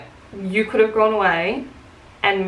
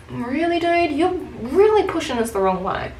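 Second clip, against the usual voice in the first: high, incredulous pushback; exasperated disbelief; 6 semitones higher than usual. Retold or imitated. Worker to a customer pushing them, high and exasperated. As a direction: pitch it up as if fed up.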